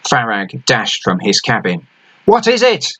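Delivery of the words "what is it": shouted up. A narrator's reading. Captain shouting up to the crow's nest, urgent. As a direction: bark it up to the lookout. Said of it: "he shouted up".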